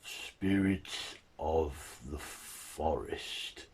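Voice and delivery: very slow, drawn-out creature voice; slow monster echoing new words; creature's halting speech. Acted. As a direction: labour over each word like a creature learning to speak. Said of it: "the creature comes out from hiding and looks and says".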